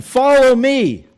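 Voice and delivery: the preacher giving Jesus's call to follow. Retold or imitated. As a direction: voice Jesus summoning, drawn out and lifted. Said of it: "he's saying".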